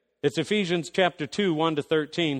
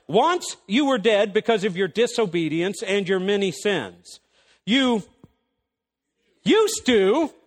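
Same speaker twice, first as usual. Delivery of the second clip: higher, louder pulpit voice driving each point; full-force preaching; 6 semitones higher, 4 dB louder than usual. Acted. Preacher rousing the congregation, a fired-up sermon voice. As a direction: preach it hard to the room.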